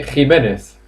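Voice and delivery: Jimenez with a Spanish jota and a lisped final z, hee-MEH-neth; Spanish pronunciation. Shown as a demonstration. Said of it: "In Spanish, we say".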